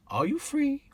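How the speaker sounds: high camp lilting question; John Inman impression. Cabbie imagining John Inman hailing his cab, camp and high. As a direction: hit his famous catchphrase high. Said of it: "John Inman come to his cab and say".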